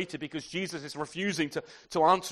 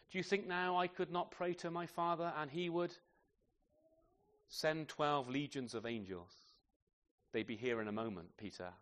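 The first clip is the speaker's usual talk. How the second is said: quiet gentle rebuke; 8 dB quieter than usual. Acted. Jesus gently rebukes Peter.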